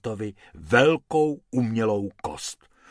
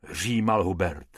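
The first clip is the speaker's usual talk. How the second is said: usual speaking voice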